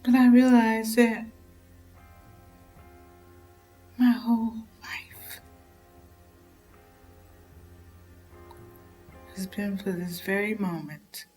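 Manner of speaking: hushed, broken whispered confession; tragic heroine sorrow. Acted. Confession after snapping.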